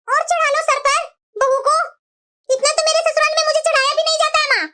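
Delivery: petulant daughter airing grievances; complaining daughter. Acted. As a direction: sulk and complain to mom. Daughter resents mother.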